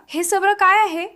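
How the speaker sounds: high-pitched puzzled question; narrated astonishment, lightly acted; bewildered. A narrator's reading. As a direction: ask in mild bewilderment, pitch lifted. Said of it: "हैराण होऊन विचारू लागला".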